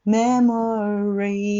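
memories slowly, with a neutral first vowel; schwa memories. Shown as a demonstration. Teacher modeling memories for the class. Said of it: "曖昧母音で".

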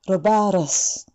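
about us drawn out slowly, flapped t into us; slow model. Shown as a demonstration. Teacher slowly modelling about us for the class. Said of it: "難しいね".

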